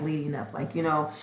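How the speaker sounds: ordinary delivery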